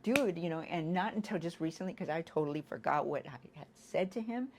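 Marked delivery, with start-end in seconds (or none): 0.0-0.4 higher, louder angry yell; retold yell. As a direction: snap the word at your son. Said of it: "I'm yelling and I'm like".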